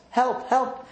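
high mock cry of help from a princess. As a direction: lightly play the damsel calling out. Princess in distress in story.

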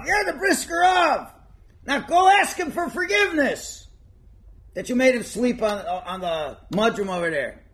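high, loud commanding voice; loud scolding order. Acted. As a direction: order him off to apologise. Rebbe scolds host to apologise.

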